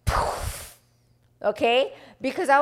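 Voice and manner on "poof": breathy puff of air, mind going blank; mind blown poof. Retold or imitated. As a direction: blow out a little "poof". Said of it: "my head literally went".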